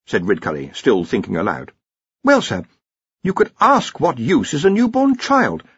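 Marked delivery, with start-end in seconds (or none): 2.2-5.6 high-pitched, anxious underling; nervous Ponder. A narrator's reading. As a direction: warn the boss in a worried high voice. Ponder in story.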